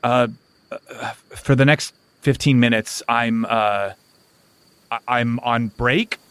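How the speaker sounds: low, halting and unsure; uneasy witch hunter. Acted. Relaxed witch hunter puzzles over a sudden break, uneasily. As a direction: stammer it out low, slowly feeling for words. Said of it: "They look at their hands uneasily".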